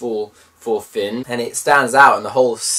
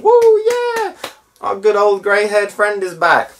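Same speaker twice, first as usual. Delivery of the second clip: high, hammed-up cheer of a delighted crowd; cheering audience; 9 semitones higher, 4 dB louder than usual. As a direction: whoop like fans welcoming an old friend back. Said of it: "This is the whole audience applauding".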